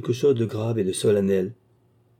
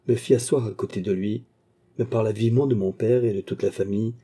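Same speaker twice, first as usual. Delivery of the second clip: ordinary delivery, nothing marked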